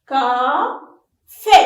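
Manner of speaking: "Café" as two loud, separate beats; loud classroom syllable count on "Café". Shown as a demonstration. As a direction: hold "Ca", pause, then land "fé". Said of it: "Frappez-le en syllabe".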